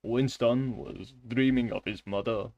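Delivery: put-on slow Russian-accented reading; deep, deliberate foreign accent. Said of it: "they all have deep voices".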